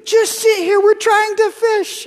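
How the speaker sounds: put-on near-crying frustration at a wriggling toddler; frustrated dad. Dad scolding his toddler in the boat, high exasperated whine. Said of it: "getting frustrated, like, at my two-year-old son".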